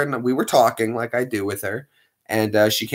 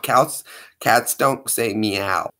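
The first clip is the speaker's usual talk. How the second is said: normal talking voice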